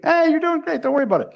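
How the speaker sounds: high, bright-pitched mock reassurance to a client; chirpy reassurance. Practitioner reassuring a client who looks great, in a high cheery mock voice.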